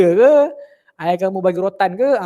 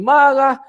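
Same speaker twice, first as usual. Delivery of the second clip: marah said higher and louder, falling; stressed scold word; 5 semitones higher, 4 dB louder than usual. Retold or imitated. Teacher listing a mother's scoldings to pupils, punching marah high and loud.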